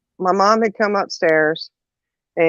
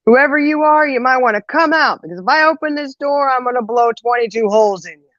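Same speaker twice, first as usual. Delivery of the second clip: mom's threat called out in a higher voice; mock yell of a threat, more pitch than volume; retold yelled warning; 7 semitones higher than usual. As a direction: call out a warning, pitched well up. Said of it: "she yells out".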